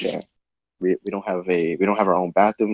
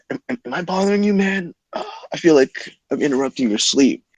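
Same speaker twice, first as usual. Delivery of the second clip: drunk faux concern; 5 semitones higher, 4 dB quieter than usual. Drunk roommate asks, faux-polite.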